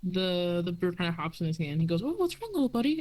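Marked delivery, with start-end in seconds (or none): 2.0-3.0 high, kindly elf voice; gentle forest elf. Acted. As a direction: coo over the bird like a kind woodland elf.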